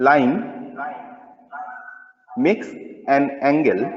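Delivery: slow, word-by-word dictating teacher; slow dictation. Acted. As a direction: spell it out slowly for note-taking.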